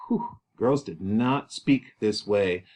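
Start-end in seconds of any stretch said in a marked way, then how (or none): 0.1-0.3 short blown-out breath; breathy phew; whew. Real, not acted. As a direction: exhale a "whew" at the defiance. Reaction to Juliet's defiance.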